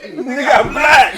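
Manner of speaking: slow, loud laughing punchline; laughing retort. Real, not acted. To the interviewer's question, a loud slow laughing retort.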